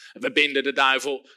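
low mock chant of binding the devil; mocking the binding prayers. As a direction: mimic a rote weekly ritual. Mocking ritual deliverance prayers.

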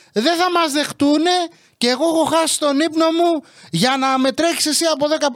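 high-pitched, insistent complaining; nagging mother. Retold or imitated. Mother complaining about the queue, high nagging. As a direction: imitate mum launching into a tirade. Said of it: "Και αρχίζει τώρα η άλλη".

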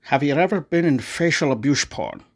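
hammed-up Scottish movie-star impression, S's slurred to sh; Sean Connery. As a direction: put on a thick mock-Scottish accent. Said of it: "Mister Sean Connery".

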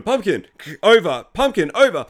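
loud, punched safe-word signal; urgent radio call. Retold or imitated. Man signalling police with the safe word, urgent loud call. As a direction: call it out like a radio distress code.

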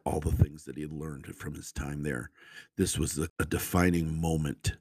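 ordinary delivery, nothing marked

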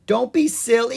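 high scream breaking out on the last word. A narrator's reading. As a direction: turn the scolding into a shriek. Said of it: "Ella screamed".

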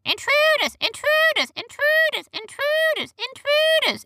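very high squawky parrot alarm; shouting parrot. A narrator's reading. Startled parrot alerts the guards, high squawked shouting. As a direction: shriek it like a parrot, drawing out the middle. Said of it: "it started shouting".

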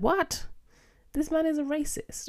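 high shocked disbelief. Real, not acted. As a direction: gasp it out, stunned. Shocked reaction.

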